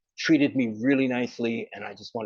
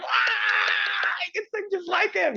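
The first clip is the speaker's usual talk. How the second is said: very high, slow swooning shout; hammed-up adoring wail; mock crowd cry; slower than usual. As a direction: wail like a starstruck fan. Mocking the crowd's reaction.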